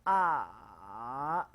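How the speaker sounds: "A" dipping low, then rising back up; third tone. Shown as a demonstration. Teacher repeating the third tone for the class. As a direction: sink "A" low and curl it upward. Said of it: "nada ketiga tuh rendah ya. Turun kemudian naik".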